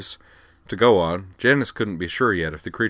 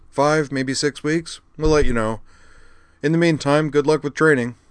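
louder, higher-pitched voice for the friend; Cochrane; 8 semitones higher, 6 dB louder than usual. Acted. Friend answering when he will be done, higher character voice.

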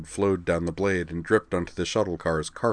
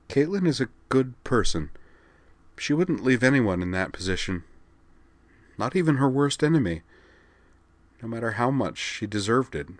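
higher, tremulous plea held back by tears; shaky voice; 5 semitones higher than usual. A narrator's reading. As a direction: defend her friend through a trembling voice. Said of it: "said in a shaky voice".

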